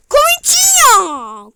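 loud, drawn-out name call; commentator-style call. Acted. Oyuncu adını uzatarak bağırma. (spiker gibi uzatarak)

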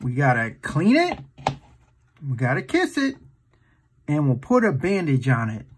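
usual speaking voice

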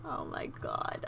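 slow, hushed awe; breathless whisper. Real, not acted. Whispering at the baby's cuteness. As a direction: barely voice it, overcome.

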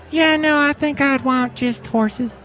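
high, slow cartoon cowgirl voice; coy rodeo queen. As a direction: muse in a high, drawn-out falsetto.

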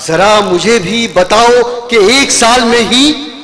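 sung-out verse with rising line ends; poetry recitation. Acted. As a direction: recite each couplet to the crowd. Poet recites verse at mushaira.

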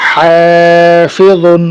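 "হাফিজুন" with long held "হা", short endings; stretched reading. Shown as a demonstration. Teacher joining syllables for students, "হা" drawn out. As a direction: sustain the first vowel of "হাফিজুন". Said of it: "টানা পড়ে চলে যাবো".

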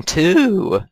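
loud slow announcement, voice cracks. Real, not acted. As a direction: voice cracking on the title. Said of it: "you hear my voice crack right there".

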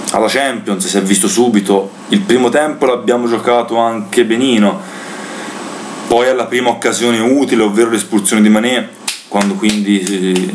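ordinary talk

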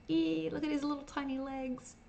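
high, soft squeal of delight; squeaky cooing. Real, not acted. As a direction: coo over something tiny, high and small. Cooing over a tiny character.

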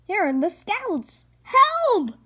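high, loud cry for help; frightened cry. Acted. Scouts crying out in terror at the wailing cave. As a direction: scream for rescue. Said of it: "they cried".

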